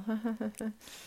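a light forced chuckle; a brief put-on laugh; short laugh. Acting out a forced cheerful self.

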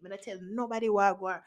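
usual speaking voice

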